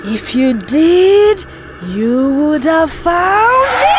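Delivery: slow, accusing ghostly wail; eerie, stretched-out angry accusation; possessed yell. Acted. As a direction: drag the words out, rising into a cry. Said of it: "Ada yelled".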